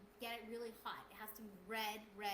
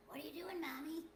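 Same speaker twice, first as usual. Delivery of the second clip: put-on higher-pitched old fairy voice; bad old fairy; 7 semitones higher than usual. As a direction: play a changeling baby with an old fairy's voice. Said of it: "in his bad fairy old voice".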